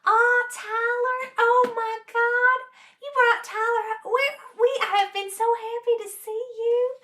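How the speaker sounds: high, drawn-out Southern gushing; Southern accent. Retold or imitated. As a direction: imitate a delighted Southern relative, stretching the vowels. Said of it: "Southern charm is a real thing".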